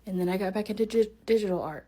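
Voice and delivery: rushed through at speed; really fast. Shown as a demonstration. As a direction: blurt it out quickly. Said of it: "That's hard to say really fast".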